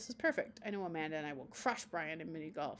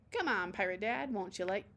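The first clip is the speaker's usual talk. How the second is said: ordinary delivery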